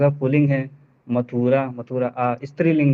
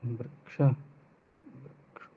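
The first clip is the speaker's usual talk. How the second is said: whisper; 13 dB quieter, much slower than usual. Real, not acted. Student drilling forms to himself.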